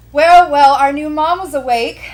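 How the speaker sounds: loud, high mocking greeting; loud, brash woman. A narrator's reading. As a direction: announce it brashly to the whole ward. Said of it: "the loudest woman in the group".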